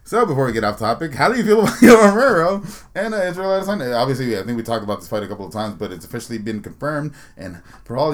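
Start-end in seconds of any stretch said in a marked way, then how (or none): none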